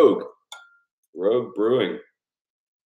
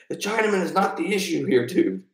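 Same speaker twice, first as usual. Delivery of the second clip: lightly voiced Dude line, pitched higher; movie quote; 10 semitones higher than usual. Quoting the Dude to stream chat, lightly voiced. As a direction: toss off the Dude's line, raised and loose.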